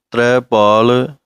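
ਤ੍ਰੈ stretched long, slight pause before ਪਾਲ; long ਤ੍ਰੈ, small gap. Shown as a demonstration. Teacher showing students the pause, ਤ੍ਰੈ drawn out then ਪਾਲ. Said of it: "ਤ੍ਰੈ ਨੂੰ ਲੰਮਾ ਬੋਲ ਕੇ ਪਾਲ ਬੋਲਣਾ ਹੈ".

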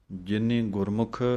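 normal talking voice

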